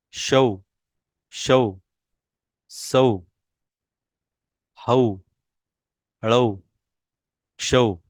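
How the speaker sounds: each syllable said alone, clearly, with a pause after; syllable drill. Shown as a demonstration. Teacher reads the au chart to the class, clear and deliberate. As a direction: recite the chart one syllable at a time.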